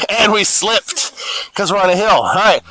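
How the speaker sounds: ordinary talk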